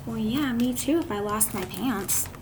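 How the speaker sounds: ordinary delivery, nothing marked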